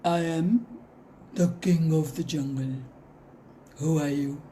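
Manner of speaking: lion king voice. Acted. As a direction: boom it out like the king of the jungle. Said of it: "And the lion says".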